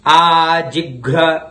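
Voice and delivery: आजिघ्र said slowly, घ्र distinct; clear ghra. Shown as a demonstration. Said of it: "उच्चारण को देखिए". (आजिघ्र धीरे और साफ़)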